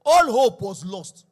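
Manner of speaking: slow, loud, heavy pulpit proclamation; forceful preaching. Acted. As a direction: let the loss land hard from the pulpit. Preacher rising to full force.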